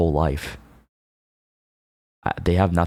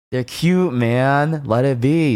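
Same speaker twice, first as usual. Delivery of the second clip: excited; 7 semitones higher, 3 dB louder, slower than usual. Real, not acted. Defends corny nicknames.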